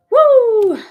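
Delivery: a loud, high whoop of joy; excited whoop. Real, not acted. Streamer whooping at her live audience, excited. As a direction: cheer out loud at the card. Cheering during live card shuffle.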